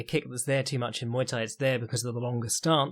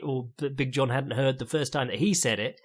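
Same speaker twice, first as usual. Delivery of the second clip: ordinary talk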